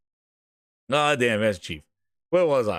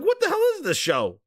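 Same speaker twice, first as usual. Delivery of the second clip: mock disbelief; 11 semitones higher than usual. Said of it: "I'm like".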